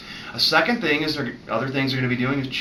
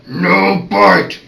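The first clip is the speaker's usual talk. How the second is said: loud, higher growled warning; growling tone; 5 semitones higher, 10 dB louder than usual. Shown as a demonstration. Vet correcting a puppy chewing his hand, loud growl. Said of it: "The other one is the growling tone of voice".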